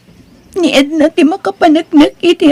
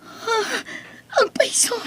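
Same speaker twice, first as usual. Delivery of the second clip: shocked cry; 9 semitones higher, 12 dB quieter than usual. Acted. Sister reacts in shock.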